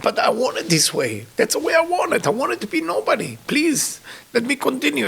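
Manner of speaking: high, choked-up retold plea; tearful pleading. Maharsha begging to be left a nobody, tearful pleading. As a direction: imitate a humble man begging, voice high.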